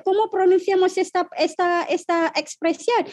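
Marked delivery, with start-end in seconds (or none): none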